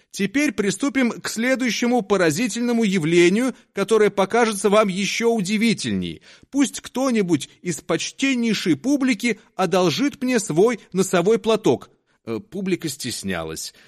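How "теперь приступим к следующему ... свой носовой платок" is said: lightly raised showman voice; a hint of a fairground conjuror building it up; conjuror's announcement. Conjuror announcing the next marvel, light showman patter.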